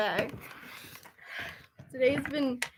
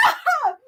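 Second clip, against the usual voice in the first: excited squeal; 12 semitones higher than usual. Real, not acted.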